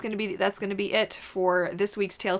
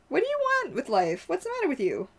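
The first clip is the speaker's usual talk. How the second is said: much higher fussing voice to the dog; pet talk; 11 semitones higher than usual. Real, not acted. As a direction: scold the dog sweetly. Talking to her dog.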